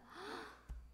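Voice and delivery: 높게 숨을 헉 들이쉬는 소리; 충격에 숨을 헉 들이켜기. Retold or imitated. Shock at arthritis diagnosis. (의사 말을 듣는 순간 놀라 헉 하는 숨소리)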